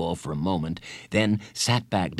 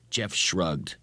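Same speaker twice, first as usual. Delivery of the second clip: ordinary delivery, nothing marked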